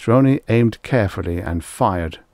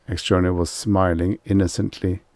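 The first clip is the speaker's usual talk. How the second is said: usual speaking voice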